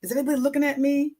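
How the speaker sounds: self-conscious dieter fretting at the pool, mock anxious; mock self-conscious worry. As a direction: imitate a nervous glance round, quickly. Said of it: "And then you say".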